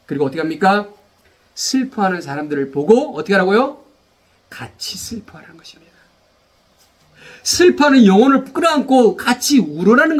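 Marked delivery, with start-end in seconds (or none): 4.5-5.9 unvoiced tender whisper. Real, not acted. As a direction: say it softly as if beside a mourner.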